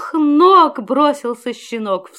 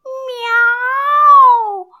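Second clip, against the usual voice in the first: kitten meow; 9 semitones higher, 4 dB louder than usual. A narrator's reading. Hidden kitten meows.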